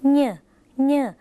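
เน่อ as a nasal ny, up in the nose; nasal sound. Shown as a demonstration. Teacher likens it to Isan speech, nasal ny in เน่อ. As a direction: send the ny of เน่อ through the nose. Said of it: "เป็นเสียงขึ้นจมูก".

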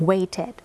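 "waited" with -ed sounded as its own syllable; extra -ed syllable. Shown as a demonstration. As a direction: say "waited" as wai-ted. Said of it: "เพิ่มอีกหนึ่งพยางค์".

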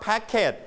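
packet in two syllables, "pack-ket"; wrong extra syllable. Shown as a demonstration. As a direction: sound the ending of packet as a full "ket". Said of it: "we don't pronounce packet". (packet อ่านผิดเป็น "แพ็ก-เค็ท")

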